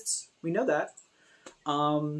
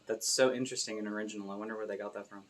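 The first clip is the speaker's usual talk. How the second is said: dry sarcasm; 5 semitones lower, 7 dB quieter, faster than usual. Real, not acted. Sarcastic reply to joke.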